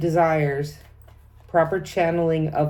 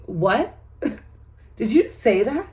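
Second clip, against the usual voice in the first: higher-pitched incredulous questioning; mock disbelief; 6 semitones higher than usual. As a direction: act taken aback, pitch jumping up on the questions. Acted shocked reply to crude compliment.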